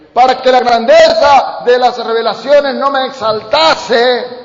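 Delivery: loud rising preaching chant; fiery preaching. Acted. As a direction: build it up to a shout for the congregation. Preaching climax. (predicación enardecida, a gritos)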